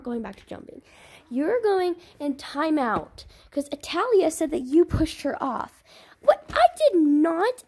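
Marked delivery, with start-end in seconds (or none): 6.2-7.6 high loud denial, slow; indignant kid. Pretend. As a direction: protest like an offended kid. Child denies accusation.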